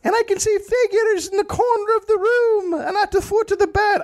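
very high falsetto wail; hammed-up delirious lament; bewitched Elizabeth. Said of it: "Elizabeth was like".

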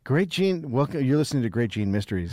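a playful, lightly done radio announcer; mock show intro. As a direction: introduce the podcast like a radio host.

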